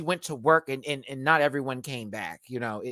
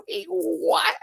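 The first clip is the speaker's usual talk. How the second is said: high-pitched mock double take; stunned disbelief. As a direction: replay a baffled reaction, pitched way up. Retold stunned reaction to remark.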